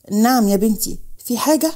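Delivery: plain reading voice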